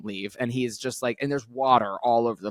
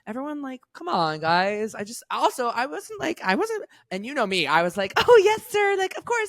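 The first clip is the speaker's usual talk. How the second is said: much higher, timid, eager-to-please voice; meek squeaky yes-sir; 12 semitones higher, 3 dB louder than usual. Retold or imitated. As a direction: squeak it out like a scared pushover. Retelling meek reply to threat.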